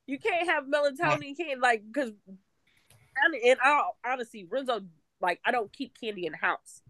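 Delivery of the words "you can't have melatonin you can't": mock scolding child. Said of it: "I'm like".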